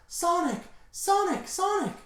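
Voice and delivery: repeated falsetto cries of alarm; hammed-up panicked heroine squeal; Blaze. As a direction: call out to a fallen friend, very high. Blaze calls to fallen Sonic.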